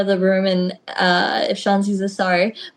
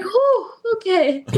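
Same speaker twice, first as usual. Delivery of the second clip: slow, higher sigh of relief; relieved exhale; 10 semitones higher, slower than usual. Retold or imitated. Rookie relieved after a good match, slow relief. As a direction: let the tension out slowly.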